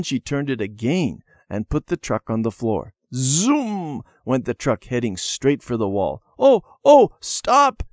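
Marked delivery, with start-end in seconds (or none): 3.1-4.0 high, drawn-out zoom; zooming truck noise. A narrator's reading. Narrator voicing the truck racing across the floor, zooming. 6.4-7.8 very high, loud panicked cry; alarmed cry. A narrator's reading. Debbie running after the runaway truck, crying out. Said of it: "cried Debbie, running after the truck".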